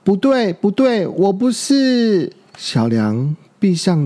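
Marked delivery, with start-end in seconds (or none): 0.1-2.3 high cry, last word drawn out; distressed denial. A narrator's reading. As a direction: protest in a raised, desperate young voice. Youngster cries out in denial. (高声拖长地拼命否认)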